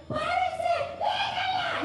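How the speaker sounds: very high shout to the kidnappers; shouting to stop them. Retold or imitated. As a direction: yell it like a hero charging in. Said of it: "salgo corriendo como súper". (gritándoles a los secuestradores, agudo y fuerte)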